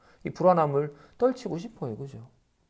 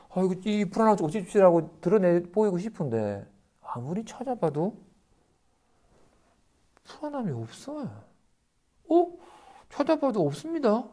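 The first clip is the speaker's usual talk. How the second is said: high, wondering search; be baffled that it is gone; puzzled surprise; 6 semitones higher than usual. Retold or imitated. A disciple searching for his anxiety, high and puzzled.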